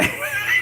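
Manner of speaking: high-pitched, wheezy giggle. Real, not acted. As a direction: giggle excitedly to yourself. Chuckling over love of fighting.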